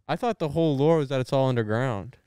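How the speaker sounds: ordinary talk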